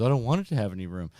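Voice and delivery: loud, rapid mock panic; imitated kid panicking. Son refusing a looser lap bar, loud mock panic. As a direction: blurt it like a panicked child. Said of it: "He's in full panic mode".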